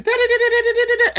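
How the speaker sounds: very high sung beeps; imitated phone alert. Mimicking her phone going off, high beeping. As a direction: chirp like a notification tone. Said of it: "My phone kept saying".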